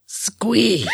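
gruff Batman voice squealing; Batman squee. Pretend. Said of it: "the Annie squee in the Batman Ash voice".

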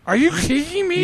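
high, lisping imitation of Sid Haig; mock the lisping vampire actor. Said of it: "they were all lisping".

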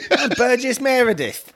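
high, silly Cockney voice; hammed-up Cockney impression; mock Cockney. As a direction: squeal it in a fake Cockney accent. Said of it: "Oh, he's Cockney".

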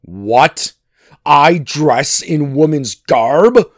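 loud, slow outraged question; indignant shout. A narrator's reading. Thor told to wear a bridal dress, shouting. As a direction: boom it in disbelief. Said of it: "shouted Thor".

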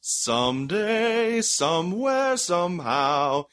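slow, high, wistful and hammy; dramatic. Acted. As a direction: drag it out like a melodramatic actor. Wistful mock-dramatic delivery.

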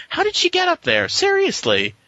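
high-pitched baffled questioning; incredulous. Real, not acted. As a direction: pitch it way up in disbelief. Exasperated repeat of question.

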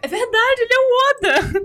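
high, excited agreement. Real, not acted. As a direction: gush with delight.

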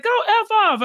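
high, mocking retort; hammed-up dismissive husband; mock Gino. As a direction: squeak it out like a rude jab. Gino's rude reply, mocked.

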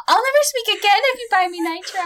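put-on high cutesy e-girl voice; playful Discord kitten pleading. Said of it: "Discord kitten voice".

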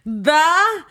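slow, mangled through a mouth stretcher; mouth stretched open. Real, not acted. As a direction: fight the lips to form words. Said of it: "hard to get the shit part out of your mouth".

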